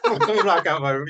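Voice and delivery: loud laughing repeat of the phrase. Real, not acted. Host laughs at the guest's blackout, loud and laughing.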